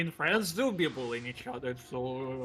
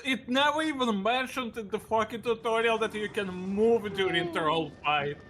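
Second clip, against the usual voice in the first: usual speaking voice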